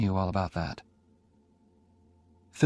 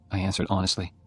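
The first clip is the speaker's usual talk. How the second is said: usual speaking voice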